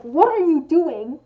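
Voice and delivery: loud, slow demo of an angry question; accusing. Shown as a demonstration. Crafter showing the tone of the full question, accusing. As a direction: spell it out like a mild telling-off. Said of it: "almost implies, like, anger".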